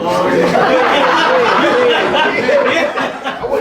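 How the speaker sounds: big high laugh breaking out; cracking up helplessly; loud burst of laughter. Real, not acted. Cracks up at his own nerves.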